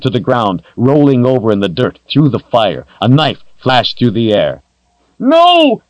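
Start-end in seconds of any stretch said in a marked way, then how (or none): 5.2-5.8 shrill high scream of no; desperate high scream of no. A narrator's reading. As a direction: scream it in horror, much higher and louder. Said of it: "Kalen screamed".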